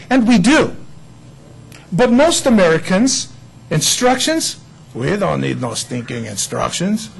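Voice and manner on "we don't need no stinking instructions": gruff bandit voice, much lower and softer; tough-guy movie bandit. Retold or imitated. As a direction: sneer it like a movie bandit refusing help. Mocking the stubborn American attitude.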